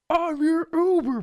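high slow drawn-out call; hammed-up high hail; mock driver call. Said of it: "And he's just like".

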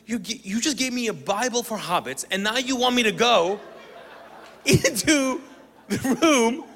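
high, mounting comic outrage, ending loud; incredulous protest. Retold or imitated. Sent to face demons with a tiny Bible, incredulous. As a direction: build to loud disbelief, spacing out the final words.

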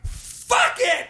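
high-pitched retold blow-up; exasperated outburst. Customer giving up on the cashier, exasperated outburst. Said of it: "I go like this".

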